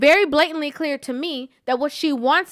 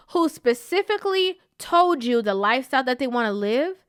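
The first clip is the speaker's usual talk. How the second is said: normal talking voice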